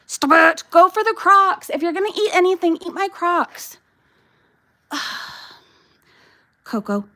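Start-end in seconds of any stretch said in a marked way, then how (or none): 0.1-3.8 high, loud scold. Real, not acted. Owner scolds her dog to go for the Crocs instead.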